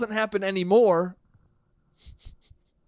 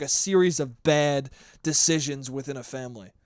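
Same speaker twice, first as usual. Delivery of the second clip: normal talking voice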